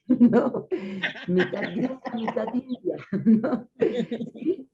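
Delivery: laughing through the teasing question; amused, laughing question. Real, not acted. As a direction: giggle while asking it. Teasing about the grindia nickname.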